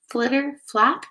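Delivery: plain reading voice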